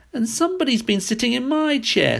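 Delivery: high squeaky little bear voice; baby bear squeak. A narrator's reading. Baby bear finds his chair sat in, high squeak. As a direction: squeak it out like a tiny cub. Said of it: "squeaked the baby bear in his shrill little voice".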